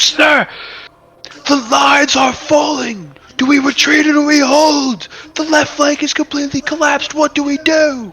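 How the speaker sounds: high, loud, panicked shouting over battle; sergeant-at-arms yelling to his commander in panic; frantic shout. Acted. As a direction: scream for orders as the line breaks. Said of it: "runs up to you and goes".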